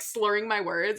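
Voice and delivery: ordinary talk, nothing marked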